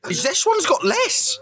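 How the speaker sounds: high, slow, disbelieving; incredulous. Real, not acted. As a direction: stretch it out in disbelief. Mock outrage emphasis.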